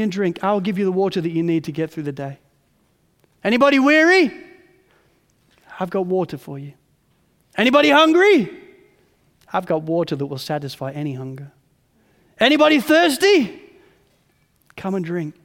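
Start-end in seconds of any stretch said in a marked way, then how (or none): 3.4-4.3 calling out. Acted. Calling out to the crowd. 7.6-8.5 calling out. Acted. Calling out to the crowd. 12.4-13.6 calling out. Acted. Calling out to the crowd.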